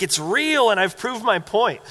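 mock triumphant bragging. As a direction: imitate someone bragging they've won the point. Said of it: "a bragging sort of way".

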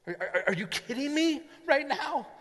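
high, disbelieving inner voice; can't believe what he just heard; incredulous. Acted. Servant inwardly disbelieving the prophet's tea question.

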